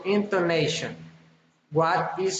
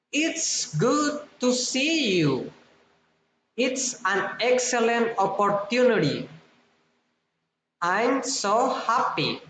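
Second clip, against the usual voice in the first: high start, dropping on "you", "opportunity", "happy"; falling exclamations; 4 semitones higher than usual. Shown as a demonstration. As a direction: exclaim high, then let "happy" fall. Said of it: "falling intonation".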